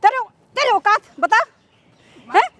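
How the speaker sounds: outraged mother's tirade; scornful, indignant scolding; angry scolding. Acted. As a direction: berate him with indignant questions. Acted mother challenges servant.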